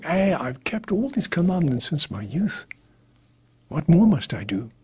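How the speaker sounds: mock boastful young man; proud. Young man bragging to Jesus about the commandments, mock proud. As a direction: brag it, lightly. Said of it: "he was very proud".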